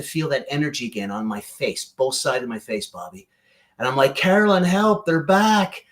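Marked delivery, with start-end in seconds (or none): 4.2-5.8 higher, louder call for help; retold frightened shout; mock panic. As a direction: cry out to her, half alarmed. Calling for help from spirits.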